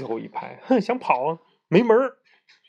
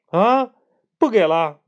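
higher, louder shocked question; dismayed surprise; 7 semitones higher, 6 dB louder, slower than usual. Acted. Boy learning he gets no egg tart, dismayed. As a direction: act crushed that the treat is gone.

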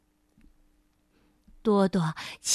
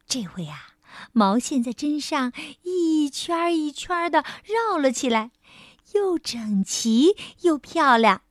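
usual speaking voice

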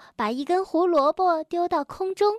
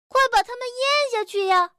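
high, eager character voice urging a friend; urgent prompting; 8 semitones higher than usual. A narrator's reading. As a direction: urge your friend on, pitched high. Acted urging in a story. (着急地催促)

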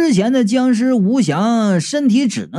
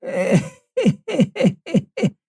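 high sneering heh-heh chuckle; a cold, mocking little laugh; 5 semitones higher, 3 dB quieter than usual. Old man sneering at the accusers, cold chuckle. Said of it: "老贾冷笑道".

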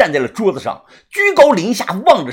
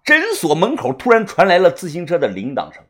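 ordinary delivery, nothing marked

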